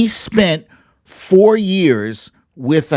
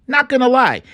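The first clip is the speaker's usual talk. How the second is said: higher, insistent second take; emphatic repeat; 9 semitones higher, faster than usual. Real, not acted. Vlogger doubling down on his admission, pitched up.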